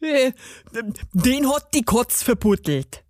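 hesitant dialect dwarf voice; flustered gnome, halting start; stammering garden gnome. A narrator's reading. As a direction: stumble into an excuse as the gnome. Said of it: "stotterte der Zwerg".

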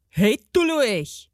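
slow, drawn-out gnome bellow; bellowing garden gnome. A narrator's reading. Gnome bellows at the girl by the pond, grumpy. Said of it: "bölkte ein Gartenzwerg".

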